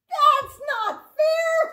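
high, slow playful whine; mock-outraged pout; mock complaint. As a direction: pretend to be cheated, playfully. Ball bounced out of cup.

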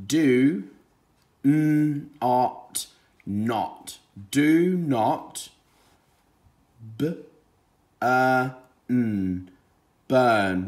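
"n-ot" and "b-, burn" broken into sounds then blended; sounding out. Shown as a demonstration. Teacher sounding out words for young readers. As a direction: sound out each word slowly. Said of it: "Let's read from the beginning".

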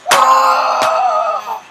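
high yelp of effort; battle cry. Real, not acted. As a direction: yell out as you strike. Fighting in a game round.

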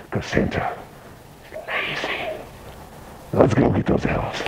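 a sly stage whisper; whispering like a scheming Santa to the kids. Acted. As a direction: let it drop to a secretive whisper. Santa conspiratorial aside to kids.

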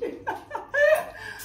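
short high-pitched laugh. Real, not acted. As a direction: let out a high giggle. Laughing before tasting.

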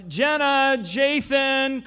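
calling across the house for them; calling out names. Retold or imitated. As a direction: call out to find your brother and sister.

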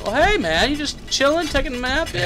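high, drawn-up playful greeting; mock-friendly. Player teasing a napping game enemy, mock-friendly. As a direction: tease him in a high voice.

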